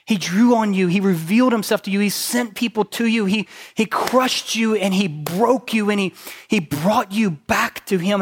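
loud, driving sermon delivery; high, loud pulpit voice; preaching at full force. Acted. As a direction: preach it hard to the room.

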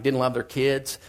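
ordinary talk, nothing marked

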